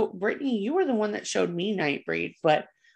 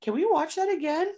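put-on high, pleading kid voice; eager kid voice; 10 semitones higher than usual. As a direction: ask like a kid who wants more. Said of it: "and I was like".

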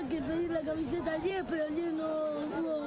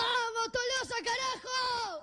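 higher, slower shout of Tolosa, carajo; cheering shout; 6 semitones higher, slower than usual. Real, not acted. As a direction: yell it like a fan in the stands. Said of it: "Decile ahora".

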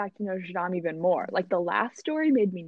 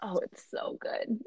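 whispered gush; 10 dB quieter, slower than usual. Retold or imitated. Mocking the gushing coffee praise.

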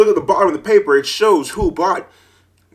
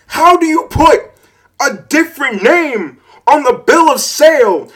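higher, louder furious tirade; angry rant; 8 semitones higher, 5 dB louder than usual. Real, not acted. Ranting at the seller's stupidity, loud and furious. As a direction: tear into the seller. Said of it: "I'm pissed".

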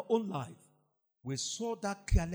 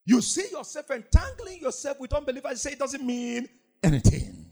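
louder, higher, driving pulpit delivery; full-force preaching; 4 semitones higher, 5 dB louder than usual. Acted. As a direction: preach it hard at the congregation.

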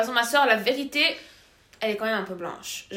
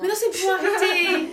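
higher, louder, laughing denial; laughing protest; 8 semitones higher, 7 dB louder than usual. Real, not acted. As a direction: protest while cracking up. Teased, protesting through laughter.

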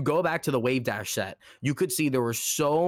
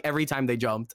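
ordinary talk

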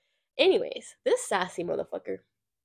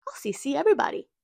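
fast, higher, louder sarcastic retort; snippy mock comeback; 9 semitones higher, 5 dB louder, much faster than usual. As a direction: fire it back fast with sarcasm. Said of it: "I'm like".

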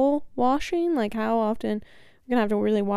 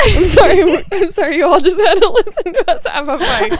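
laughing apology; 8 semitones higher, 10 dB louder than usual. Real, not acted. Laughing apology after mock fight.

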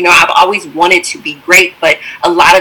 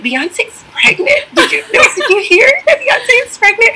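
giddy tearful excitement. Retold or imitated. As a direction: gush the news with laughing tears. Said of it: "I was like".

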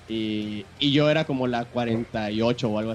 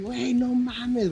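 awed; 11 semitones higher, much slower than usual. Retold or imitated. Starstruck fan meets his idol.

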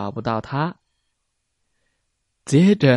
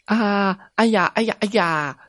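high frightened yelps; scared cries; 7 semitones higher than usual. A narrator's reading. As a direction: squeal in fright like kids. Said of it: "两个小孩儿也害怕地叫了出来". (孩子们害怕地尖叫)

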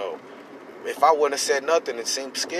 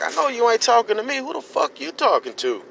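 much higher and louder, fast confrontational yell; retold angry shout; 13 semitones higher, 5 dB louder than usual. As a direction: call him out like you're ready to fight. Confronting the stranger angrily.

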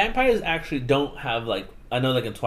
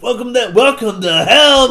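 luide uitroep als personage; 10 semitones higher, 11 dB louder than usual. Acted. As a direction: bellow a mock-grand welcome, high and stretched. Voicing Krebsley's welcome.

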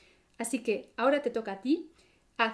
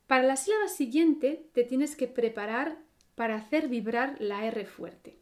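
normal talking voice

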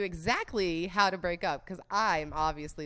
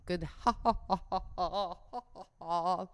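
jolly Santa laugh; 2 semitones lower than usual. Pretend. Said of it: "Like jolly old Saint Nicholas".

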